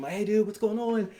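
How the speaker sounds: high, upbeat acted greeting; excited dad. Dad fetching his son off the airbag, high excited cheer. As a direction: pitch it up like greeting your kid excitedly.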